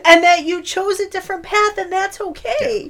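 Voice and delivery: high, real choked-up voice; on the verge of tears. Real, not acted. Podcast host moved by a film, tearful. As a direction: push the pitch up, fighting real tears. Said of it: "I'm gonna cry a second time".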